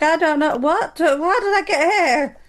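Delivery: high, laughing, bewildered voice; mock lost tourist. Caught by paparazzi, a high laughing mock bewilderment. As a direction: play dumb as if lost. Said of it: "I can just claim I'm lost".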